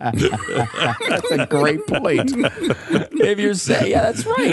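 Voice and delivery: high, laughing agreement. Real, not acted. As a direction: barely get it out through laughter. Cracking up at co-host's joke.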